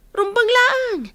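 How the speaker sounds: a sudden high jab at her niece; aunt shoots back high and scornful; sharp high retort. Acted. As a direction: snap it back in a high, sarcastic voice. Aunt retorts about electricity bill.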